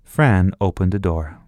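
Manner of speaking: ordinary delivery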